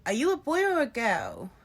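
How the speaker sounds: put-on sing-to-the-child questioning; woman talking to a child. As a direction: mimic someone quizzing a small child. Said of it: "she said to Mitzi".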